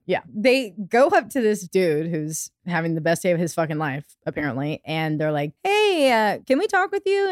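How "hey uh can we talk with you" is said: polite cop voice. Retold or imitated. Investigators approach naked man.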